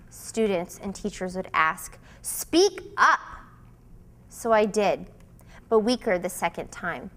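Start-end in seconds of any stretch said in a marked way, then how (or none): 2.2-3.2 raised, higher demand to be heard; sharp demand. A narrator's reading. Students and teachers demanding.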